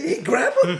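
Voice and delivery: high loud call broken by laughter; laughing mock yell. Friend urging him to grab the man overboard, laughing loud call. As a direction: yell it while cracking up. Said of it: "my friend looks at me and like".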